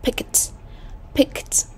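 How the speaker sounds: "Picked" ending in a released T; "Picked" modelled as one syllable, pick-t; final T sound. Shown as a demonstration. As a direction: pronounce the -ed of "Picked" as a T. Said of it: "câu D cũng là âm T".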